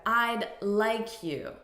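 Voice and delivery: slow "I'd like you" with "like" running into "you"; linked like you. Shown as a demonstration. Teacher slowly showing linked sounds to learners. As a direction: blend "like you" together, said slowly. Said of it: "Notice the linking of these sounds".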